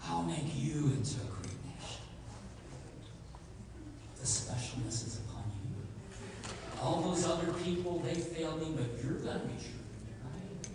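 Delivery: soft, hushed flattery, nearly whispered; coaxing God. Acted. God quietly flatters Moses with a great nation. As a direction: tempt him softly, like a secret offer. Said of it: "And then he says".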